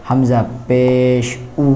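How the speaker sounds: drawn-out teacher recitation of letters; slow letter drill. Shown as a demonstration. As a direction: chant each letter slowly for students.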